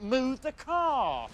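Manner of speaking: high, very drawn-out demand; pained plea. Retold or imitated. Man with a car on his foot pleading, high and drawn out. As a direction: stretch it out high, in mock agony.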